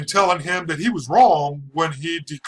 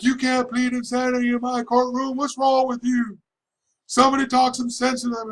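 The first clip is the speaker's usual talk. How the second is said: high, mock yelling judge; 8 semitones higher than usual. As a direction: rant like the judge losing it. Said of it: "yelling and screaming".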